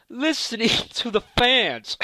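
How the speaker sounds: breaking into laughter mid-sentence; laughing. Real, not acted. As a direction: laugh through the words until it ends in a cough. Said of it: "coughing so bad just laughing".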